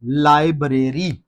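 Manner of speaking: लाइब्रेरी as lai-BRAY-ree; long BRAY in लाइब्रेरी; wrong pronunciation. Shown as a demonstration. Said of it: "गलतुल आम तरीके से". (लाइब्रेरी ग़लत, लाइ-ब्रे-री)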